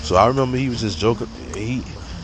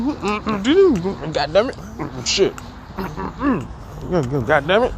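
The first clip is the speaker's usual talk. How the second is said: mock grumbling; 9 semitones higher than usual. Imitating teacher cursing under breath.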